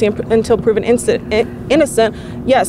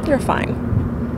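dismissive whisper; 3 semitones higher, 6 dB quieter than usual. Real, not acted.

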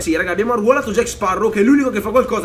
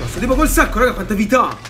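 usual speaking voice

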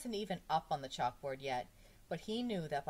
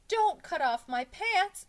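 high, loud, stretched-out protest; slow schoolboy wail; alarmed plea; 13 semitones higher, 11 dB louder, slower than usual. A narrator's reading. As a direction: beg loudly, word by word. Pupil pleads with teacher.

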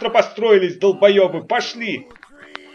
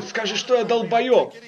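ordinary talk, nothing marked

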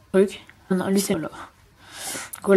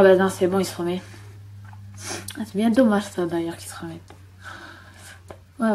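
ordinary talk, nothing marked

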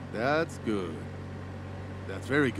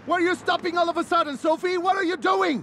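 angry yelling; 7 semitones higher than usual. Acted. Instructor to Sophie after she suddenly stops the car, a fast, high angry yell. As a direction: shout at her for braking out of nowhere.

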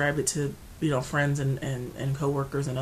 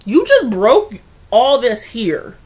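loud, slow disbelief; loud slow exasperation; incredulous outburst; 7 semitones higher, 14 dB louder, much slower than usual. Real, not acted. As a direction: spell out how absurd it was, loudly. Incredulous at mom driving herself.

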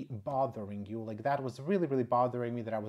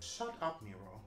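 self-scolding; 5 semitones lower, 5 dB quieter than usual. Real, not acted. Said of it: "I just need to stop talking".

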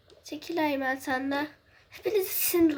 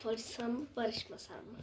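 whispering; 6 semitones lower, 13 dB quieter than usual. Pretend. Sulking reply in a quarrel.

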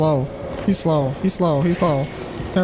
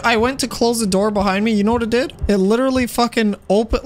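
usual speaking voice

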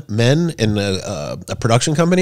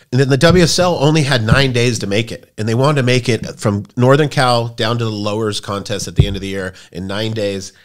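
ordinary talk, nothing marked

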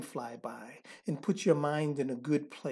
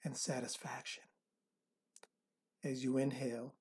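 soft spa whisper; 3 semitones lower, 8 dB quieter than usual. Acted. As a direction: guide them into calm, breath by breath. Leads a counted relaxation meditation.

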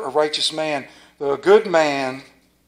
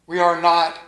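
ordinary talk

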